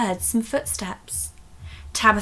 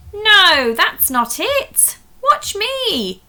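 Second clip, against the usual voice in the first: much higher, loud bossy cry; shouting; 12 semitones higher, 9 dB louder, slower than usual. Acted. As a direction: shout to stop them. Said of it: "shouted Beatrix".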